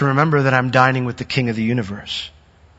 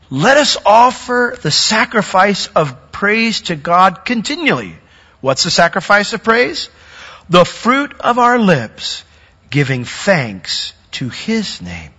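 slow, higher preached reading; scripture reading; 6 semitones higher than usual. Acted. Pastor reading Hebrews to congregation, slow preaching. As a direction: read the verse with weight, slowly.

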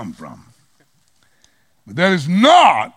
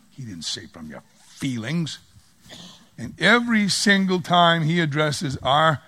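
usual speaking voice

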